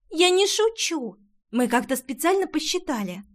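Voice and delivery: put-on high, eager chattering girl; excited girl voice. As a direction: rattle it off like an excited kid.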